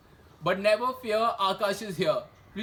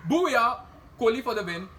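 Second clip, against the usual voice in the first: cricket celebration; 4 semitones higher, 7 dB louder than usual. Real, not acted.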